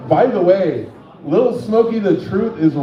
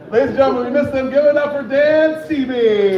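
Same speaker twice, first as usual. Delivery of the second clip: stage announcer; 6 semitones higher than usual. Real, not acted. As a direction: build it up and stretch the comic's name. Host introduces the next comic.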